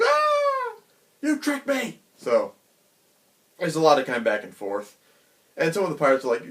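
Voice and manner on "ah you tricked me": put-on a very high, squeaky, indignant kid's cry; outraged kid. Young Luffy realizing Shanks fooled him, a very high, indignant cry. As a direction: wail like a cheated little boy. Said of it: "then Luffy's like".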